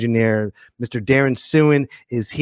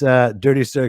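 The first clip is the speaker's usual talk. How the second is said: ordinary talk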